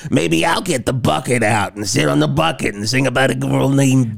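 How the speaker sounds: spot-on rock drummer impression. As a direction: impersonate a rambling New York rocker. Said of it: "a note for note impression of them".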